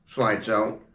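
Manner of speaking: usual speaking voice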